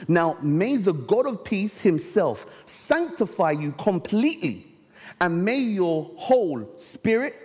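preacher reading the Bible to the church, slow and solemn; scripture reading. Acted. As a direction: lift the text out slowly for the congregation. Reads scripture aloud.

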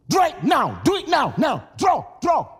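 loud, urgent command; urgent shout. Acted. Preacher voicing Jesus urging servants, loud and urgent. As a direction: bark the order again and again.

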